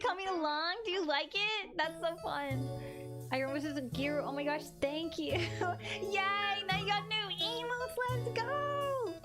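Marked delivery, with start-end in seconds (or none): none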